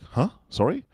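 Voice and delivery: high, questioning imitation of confusion; baffled, pitched right up; puzzled concierge. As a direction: ask as if you didn't catch it. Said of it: "And they go like".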